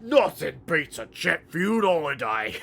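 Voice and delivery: gruff Cockney ork take on the meme; a hint of Cockney ork; mock ork voice. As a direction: say it like a Warhammer ork. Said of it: "as a forty K orc".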